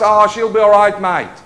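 loud, laid-back Australian "she'll be all right, mate"; Aussie accent. Retold or imitated. Preacher mocking a careless believer's attitude, Australian accent. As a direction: toss it off in broad Australian. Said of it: "You know that Aussie thing".

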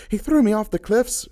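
high, loud indignant shout; a woman shouting her accusation, high and loud. A narrator's reading. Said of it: "she shouted".

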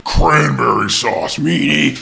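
slow, loud mock-menacing turkey; villainous turkey voice. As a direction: relish the threat like a cartoon villain. Repeats the turkey villain's threat.

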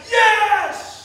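loud high "Yes!"; excited cheer. Retold or imitated. Churchgoers cheering when promised blessings, loud excited yes. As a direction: cheer it out, thrilled.

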